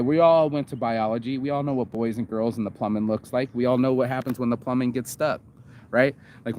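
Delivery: usual speaking voice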